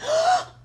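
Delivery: sharp high gasp of fright; mock scared gasp at the owl; startled gasp. Startled by a giant owl, sharp gasp. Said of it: "and I was like".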